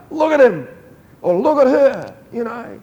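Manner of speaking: high, loud delighted exclamation; God delighted. Pretend. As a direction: point them out with joy. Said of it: "And he says, like".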